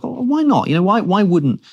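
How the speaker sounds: puzzled questioning. Acted. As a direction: wonder aloud, pitch jumping up. Voices reader's puzzled reaction to text.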